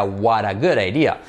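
slow "what a good idea", Ts and Ds tapped; linked model phrase. Shown as a demonstration. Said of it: "Repetid todos". ("what a good idea" como "uaraguraidía", lento)

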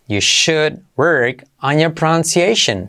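unhurried teaching pace; slow it down for learners. Shown as a demonstration. Teacher to students, slow model sentence. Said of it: "slow model then normal".

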